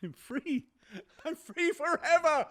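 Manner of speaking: rising to a high, loud shouting laugh; maniacal laughter. Acted. Murderer exulting in his freedom, shouting and laughing. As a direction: cackle with mad triumph. Manic triumph.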